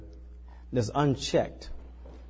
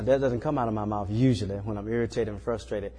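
ordinary talk, nothing marked

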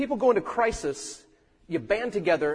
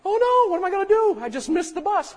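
high, loud mock panic over a missed bus; hammed-up alarm at the bus stop; 5 dB louder than usual. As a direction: play up the dismay of missing the bus. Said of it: "you miss the bus and go".

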